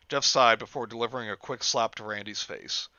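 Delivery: ordinary delivery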